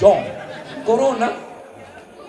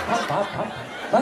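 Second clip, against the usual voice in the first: a softer, half-voiced answer to the husband; hushed mock reply; 5 semitones higher, 5 dB quieter than usual. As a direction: hiss it back so the kids don't hear. Wife answering in hushed code. (istri menjawab berbisik dari jauh)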